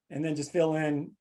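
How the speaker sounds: usual speaking voice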